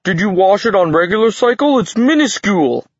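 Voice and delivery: high, loud protest; upset giant. A narrator's reading. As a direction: cry out in outraged disbelief. Giant protests over shrunken bag.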